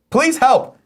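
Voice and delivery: high, pleading cry for help; high desperate plea for help. Acted. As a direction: squeak it like a trapped man begging. Reading trapped man's plea dramatically.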